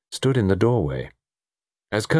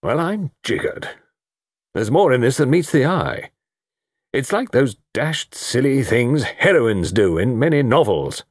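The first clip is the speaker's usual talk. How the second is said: heavy; 6 semitones higher than usual. A narrator's reading. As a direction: bluff colonel, higher and emphatic. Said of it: "Colonel Melrose was saying heavily".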